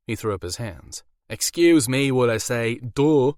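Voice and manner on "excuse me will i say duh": high, loud thick Irish brogue; Irish werewolf. A narrator's reading. Werewolf explains his overbite to Alora in Irish accent.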